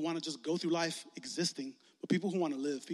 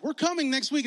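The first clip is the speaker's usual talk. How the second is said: louder and higher, eager; eager friends; 7 semitones higher, 7 dB louder than usual. Retold or imitated. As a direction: friends keen to come along. Friends announce they'll come.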